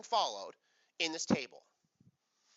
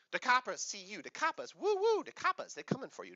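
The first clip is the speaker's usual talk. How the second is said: playful cop tease; 5 semitones higher than usual. Pretend. Playful police siren chant.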